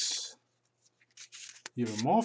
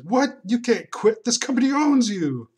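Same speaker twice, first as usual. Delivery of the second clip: high, loud outraged bellow; boss yelling; 11 semitones higher, 9 dB louder than usual. Acted. Boss guy outraged at the employee who quits. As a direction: roar it like an affronted boss.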